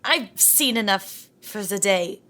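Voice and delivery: usual speaking voice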